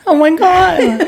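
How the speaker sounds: very high, drawn-out falsetto squeal through laughter; mocking exaggerated imitation. Boyfriend mocks girlfriend's impression of him, squealed falsetto parody. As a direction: parody her squealing version of you. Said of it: "I didn't say it like that".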